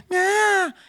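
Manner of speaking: put-on loud, high baby wail; imitated baby cry. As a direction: mimic a crying baby in one long bleat. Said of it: "creen que eso es su bebé llorando". (imitación de bebé llorando)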